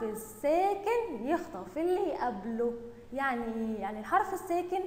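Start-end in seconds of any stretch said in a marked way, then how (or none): none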